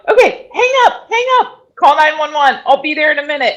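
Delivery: high, loud, rushed instructions; urgent orders. Retold or imitated. Doula urging the husband to hang up and call 911, high urgent. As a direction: snap into emergency mode and give orders. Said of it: "And I'm like".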